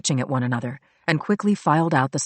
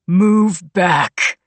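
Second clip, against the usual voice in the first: menacing; 3 semitones higher, 6 dB louder than usual. A narrator's reading. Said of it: "Brock said".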